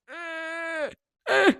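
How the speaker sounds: high, soft frozen stammer; mock stammer. Mocking herself freezing when a camera points at her, high and soft. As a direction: stall out like a deer in headlights. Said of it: "I'm like".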